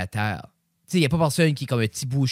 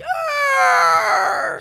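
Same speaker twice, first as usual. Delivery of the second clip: cri aigu de bête; cri de loup-garou; 7 dB louder than usual. Pretend. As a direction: hurler comme un loup-garou. Cri de loup-garou pour l'enfant. (cri de loup-garou joueur)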